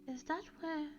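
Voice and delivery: soft, high, hushed question; whispered. A narrator's reading. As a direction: ask it in a hush, softly. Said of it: "whispered Professor McGonagall".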